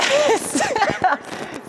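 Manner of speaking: high-pitched laugh; high giggle. Real, not acted. Laughs mid story.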